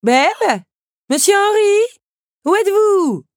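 high, loud call across the orchard. Acted. Countess calling for Belle and Henri across the orchard, high and loud. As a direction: call out to find them. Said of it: "lança une voix".

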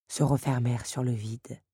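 ordinary delivery, nothing marked

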